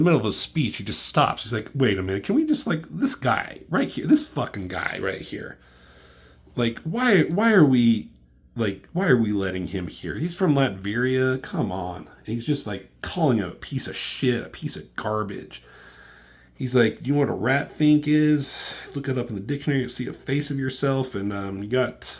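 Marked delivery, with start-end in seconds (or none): 1.7-12.1 retold indignant outburst, pitch jumping up on the protests; indignant rant. Iron Man stops mid-speech to object to this guy, indignant rant. 17.1-21.1 retold insult. Acted insult in a retold quarrel.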